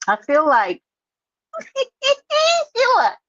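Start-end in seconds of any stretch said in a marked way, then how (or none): 1.6-3.2 Sheila squealed much higher and louder; excited squeal. Real, not acted. Host squealing hello to her friend Sheila who is back. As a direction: squeal her name with delight.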